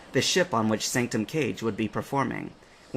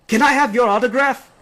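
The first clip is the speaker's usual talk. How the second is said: much higher, louder excited fan; starstruck fan; 13 semitones higher, 9 dB louder than usual. A narrator's reading. Captain recognizing Faith, starstruck excitement. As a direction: gush in delight.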